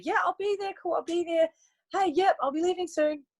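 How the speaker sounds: high, casual yes-yes; breezy promises. Retold or imitated. Friends promising to come, breezy. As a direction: toss off easy promises. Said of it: "they told me".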